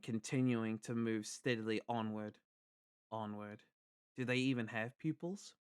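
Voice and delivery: steady narrator telling a tense tale; story narration. A narrator's reading. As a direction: read the novel aloud in an even, steady voice. Reads a novel aloud.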